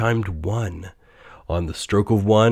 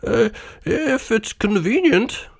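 much higher, slow, hesitant voice; timid clerk. A narrator's reading. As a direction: ask meekly, afraid to offend. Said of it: "said Bob".

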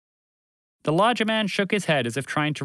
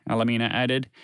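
usual speaking voice

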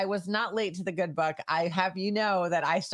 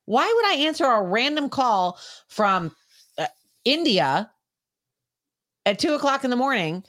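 exasperated rant; 6 semitones higher than usual. Real, not acted. Rant about stranger messages.

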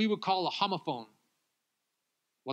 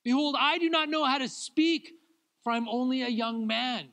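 raised, higher, louder pleading to God; pleading cry; 8 semitones higher, 4 dB louder than usual. Retold or imitated. Young prophet crying out to God, raised pleading. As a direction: Jeremiah begging God to spare him. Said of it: "He goes".

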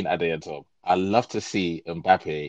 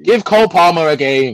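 loud call-out; 10 semitones higher, 9 dB louder than usual. Real, not acted. Calling out banter suggestion.

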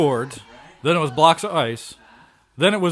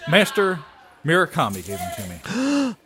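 normal talking voice

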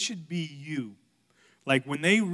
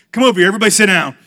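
very loud, fast, higher summons; mock stern order; 4 semitones higher, 19 dB louder, much faster than usual. Dad calling kids who think they're in trouble, mock stern. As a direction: round them up at a shout. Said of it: "they thought they were in trouble".

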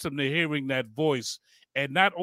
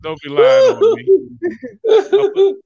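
much higher, louder squealing laugh-protest; 11 dB louder, much slower than usual. Real, not acted. Host protesting a joke about him, laughing. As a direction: cry it out through laughter, stretched slow.

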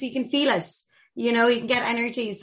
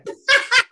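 sudden high laugh; a bright high laugh bursting out; high burst of laughter; 14 semitones higher, 5 dB louder than usual. Real, not acted. Laughing at her own setup.

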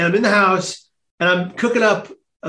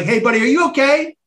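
lightly voiced officer, raised questioning pitch; concerned police officer; 6 semitones higher than usual. Imitating police officer to pacing stranger, lightly voiced concern. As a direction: call out a higher-pitched check on a stranger.

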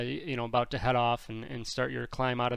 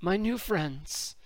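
Sorgas voice; 9 semitones higher than usual. Acted.

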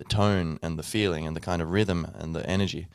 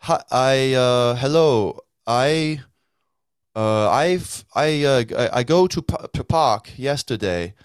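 higher, louder, slow and stumbling; halting language learner; 5 semitones higher, 6 dB louder, slower than usual. Acted. Non-native speaker struggling to tell someone about yesterday, halting. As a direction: grope for each word in a new language. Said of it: "never learnt a second language".

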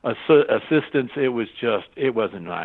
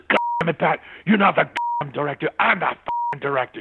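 retold angry yell; 4 semitones higher, faster than usual. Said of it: "Ralph comes up and says".

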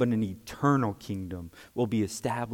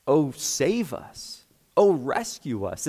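slow, drawn-out cry for help; emphatic, stretched-out appeal for rescue; emphatic plea; slower than usual. Acted. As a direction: plead it with weight on each verb. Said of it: "It's like the emphatic version".

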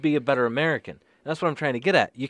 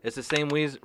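normal talking voice

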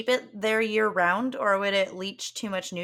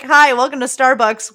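loud, higher-pitched customer-service hello; mock barista greeting; 5 semitones higher, 13 dB louder than usual. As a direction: belt out an over-eager coffee shop welcome. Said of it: "send them to the barista therapist".

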